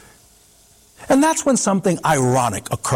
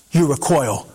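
ordinary delivery, nothing marked

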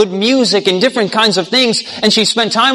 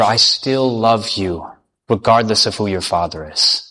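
gently acted comfort, sinking low and unhurried; tender reassurance; 11 semitones lower, 4 dB quieter than usual. King holding her in his arms, tender and reassuring.

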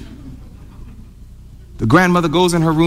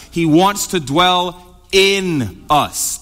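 slow, with "in" stretched long before "us"; stress on "in"; much slower than usual. Real, not acted. Preacher contrasting among and within, long stressed "in". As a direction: hold the word "in" and set it apart.

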